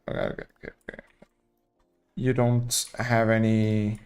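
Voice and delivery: ordinary talk, nothing marked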